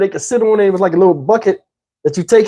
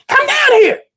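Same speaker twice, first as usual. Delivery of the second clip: very high grandma holler; high-pitched urgent scolding; yelling grandma. Retold or imitated. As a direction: imitate a grandma calling a child back. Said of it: "My grandma".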